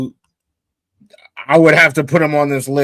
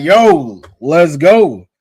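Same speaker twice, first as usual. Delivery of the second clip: loud, hyped-up cheer; super hyped; 8 dB louder, much slower than usual. Retold or imitated. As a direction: relive the hype, louder than usual talk. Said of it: "I was super hyped".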